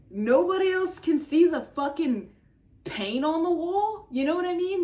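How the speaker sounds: high, loud incredulous rant; fed-up outburst; exasperated. Real, not acted. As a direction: plead with the audience in disbelief. Venting at comment section.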